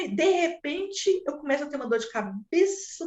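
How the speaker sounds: usual speaking voice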